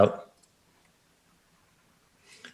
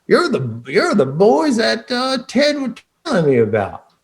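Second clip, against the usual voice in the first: Fred's greeting; 10 semitones higher than usual. Acted. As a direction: pitch it up for the eager clerk. GM voices Fred greeting the party.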